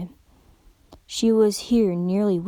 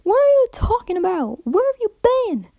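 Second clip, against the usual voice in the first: exasperated questioning; 12 semitones higher, 5 dB louder than usual. Acted. Applejack questions a rambling friend.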